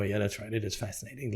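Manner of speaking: quietly agreeing, calmed down; calm agreement. Retold or imitated. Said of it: "And I go".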